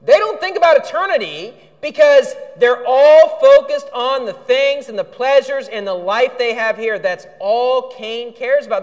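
ordinary talk

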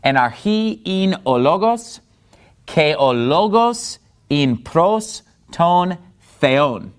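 deliberate Greek, "ologos" stressed on "lo"; stress on accented syllables. Shown as a demonstration. Teacher reading the verse by its accent marks, deliberate stresses. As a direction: press the last syllable of "archi" and "theon". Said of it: "that's where the accent mark is".

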